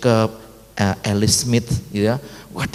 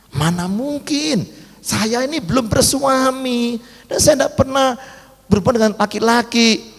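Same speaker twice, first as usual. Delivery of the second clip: incredulous protest; 9 semitones higher than usual. Acted. Maria tells the angel she has no husband, incredulous protest. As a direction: protest in a raised, astonished voice.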